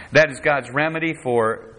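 usual speaking voice

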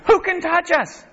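high-pitched mock bravado; hammed-up, untouchable boasting; cocky boast. Preacher voicing Judah's smug people, cocky and high-pitched. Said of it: "They thought".

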